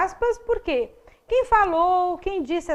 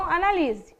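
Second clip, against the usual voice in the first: ordinary talk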